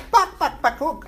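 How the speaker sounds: sudden high cluck, then back down; chicken clucking. Pretend. Teacher doing a chicken cluck for the class. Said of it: "How does the chicken sound?".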